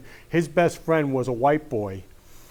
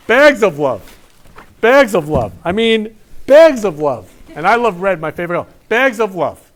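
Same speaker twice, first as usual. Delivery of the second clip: louder, higher, punched "bags of love"; proud, loud delight over gifts; excited announcing; 3 semitones higher, 8 dB louder than usual. Real, not acted. Host showing off Mena's gifts of love, excited.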